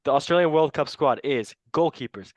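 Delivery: ordinary talk, nothing marked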